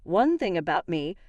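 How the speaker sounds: ordinary delivery, nothing marked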